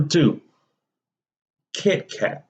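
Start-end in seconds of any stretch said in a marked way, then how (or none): none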